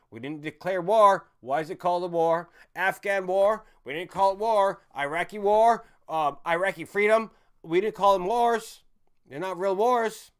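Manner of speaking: loud, heated, slowed and hammering each word; angry rant. Real, not acted. Ranting to viewers about undeclared wars, loud and angry. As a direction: build the outrage and pound out every point.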